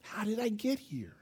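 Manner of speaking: gasp, then a soft whisper; stunned hushed question; shocked whisper. Retold or imitated. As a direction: whisper in dazed disbelief. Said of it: "we're like".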